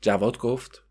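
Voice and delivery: ordinary delivery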